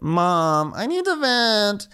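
high whine stretched at the end; mock whiny daughter. Daughter calling up her mom, mock whiny.

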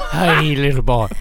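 low coaxing voice for a pet; put-on pet talk; talking-to-animals voice. Shown as a demonstration. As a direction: greet a small animal in a throaty coo. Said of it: "that's talking animal voice".

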